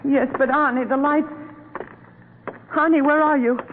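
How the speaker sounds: high, anxious calling; afraid. Acted. Wife calling husband in the dark tunnel, afraid.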